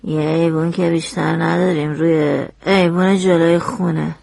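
low, grudging reply; sullen witness girl. Acted. Witness girl grudgingly answering the lawyer, low voice. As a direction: answer like the question is stupid.

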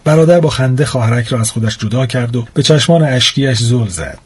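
ordinary delivery, nothing marked

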